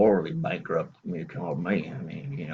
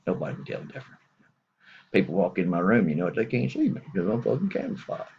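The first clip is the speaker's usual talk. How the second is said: ordinary talk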